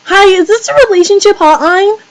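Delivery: prank caller voice. Pretend. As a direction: pitch it up to fool mom on the phone.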